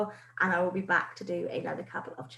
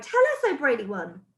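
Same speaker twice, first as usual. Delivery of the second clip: witches crying out; 4 semitones higher, 6 dB louder than usual. Acted. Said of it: "they cried".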